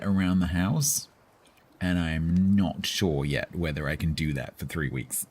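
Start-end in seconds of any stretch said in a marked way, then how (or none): none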